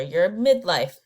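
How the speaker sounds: ordinary talk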